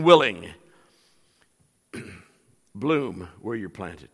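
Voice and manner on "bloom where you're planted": lower, softer and slow; soft refrain. Real, not acted. Preacher repeating motto to congregation, low and soft. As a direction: let the refrain settle quietly.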